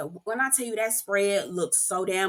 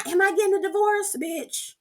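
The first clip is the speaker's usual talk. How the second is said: mock plea; 9 semitones higher than usual. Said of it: "to my".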